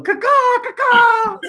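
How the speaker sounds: high screeching caw-caw; shrill screeching bird call. Shown as a demonstration. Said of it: "I go".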